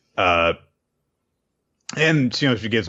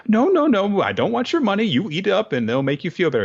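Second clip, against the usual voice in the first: put-on much higher, louder, insisting old woman; motherly old woman; 13 semitones higher, 4 dB louder, faster than usual. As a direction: push the food on her like a doting grandma. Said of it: "And she's like".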